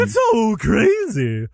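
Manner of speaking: high, very slow clown drawl; silly clown. Acted. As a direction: stretch it out like a clown. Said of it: "I was going for any kind of clown voice".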